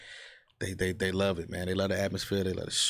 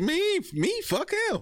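much higher, mocking squawk; much slower than usual. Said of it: "he probably gonna get on this guy and say".

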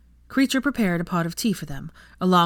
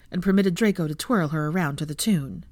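ordinary delivery

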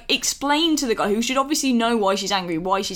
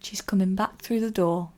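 improvised poem recital. Acted. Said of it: "I didn't know that this would be a poem".

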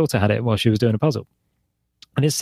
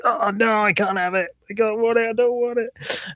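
put-on high whiny kid voice, refusing; father imitating his son refusing food; whiny child; 12 semitones higher than usual. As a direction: mimic a panicking five-year-old. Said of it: "flail arms and legs and be like".

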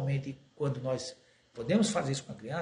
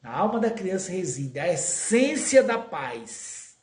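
solemn quotation; 4 semitones higher, 7 dB louder, slower than usual. Acted. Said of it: "a Meimei diz".

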